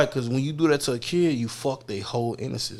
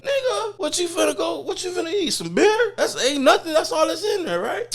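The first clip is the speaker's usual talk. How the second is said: high-pitched mocking disbelief; incredulous, squeaky-high ribbing; 4 dB louder than usual. Acting out mocking money-asker's food plans.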